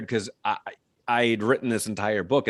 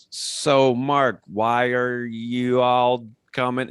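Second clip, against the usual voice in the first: slow, drawn-out overacted line reading; stilted amateur actor; hammy bad acting; 3 semitones higher, 3 dB louder, much slower than usual. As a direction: overact every word of the audition line. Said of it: "You don't have to do that".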